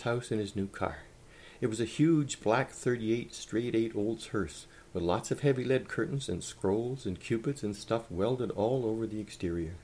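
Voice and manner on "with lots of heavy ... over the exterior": usual speaking voice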